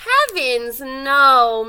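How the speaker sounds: loud, firm "no"; emphatic denial. A narrator's reading. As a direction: wave the idea away loudly. Moose reassures turkey.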